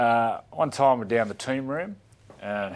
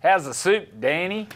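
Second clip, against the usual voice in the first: mocking catchphrase; 7 semitones higher, 5 dB louder than usual. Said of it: "someone would go".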